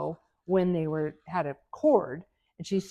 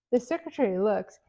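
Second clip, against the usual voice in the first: ordinary talk, nothing marked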